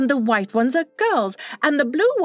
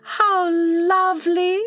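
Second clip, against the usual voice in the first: long drawn-out, higher-pitched delight; 4 semitones higher, slower than usual. A narrator's reading. Wendy hearing of the fairy house, delighted. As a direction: draw it out with girlish wonder.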